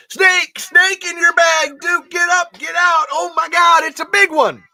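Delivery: shrieking high and loud in mock alarm; panicked shout. Leon yelling a fake snake warning at sleeping Duke, shrieking. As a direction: yell it like a snake is in the bag. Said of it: "Duke screams".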